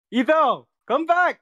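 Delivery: high, loud call for Etho to come back. Real, not acted. Stranded friend calls Etho back to stream, loud. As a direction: yell across the room for him.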